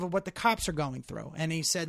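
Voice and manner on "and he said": normal talking voice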